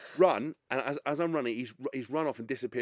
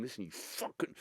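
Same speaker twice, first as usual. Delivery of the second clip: much softer, lowered warning; hushed threat; 4 semitones lower, 12 dB quieter than usual. Retold or imitated. Owner scolding his dog quietly while being photographed. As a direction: scold the dog quietly through a forced calm. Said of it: "trying not to".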